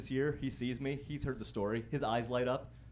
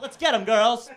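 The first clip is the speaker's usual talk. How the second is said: high, full-volume rallying shout; rally the troops at the top of your voice; battle cry; 11 semitones higher, 14 dB louder, slower than usual. Acted. To a fleet of Subaru drivers, triumphant charging yell.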